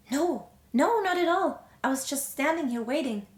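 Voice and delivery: high, lilting wifely reply; sweet reassurance. Acted. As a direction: brush off the apology sweetly. Wife reassuring husband, put-on sweetness.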